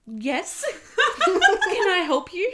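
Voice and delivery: high, loud, giggling reply; laughing through it. Retold or imitated. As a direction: try to stay polite while laughing. Answering the call, cracking up.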